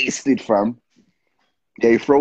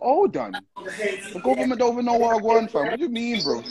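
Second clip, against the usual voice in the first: indignant inner voice; 12 semitones higher than usual. Retold or imitated. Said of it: "And I thought".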